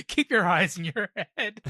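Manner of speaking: laughing through the words. Real, not acted. Co-host laughing at Luffy's cartoon eyes. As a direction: crack up while scolding the character.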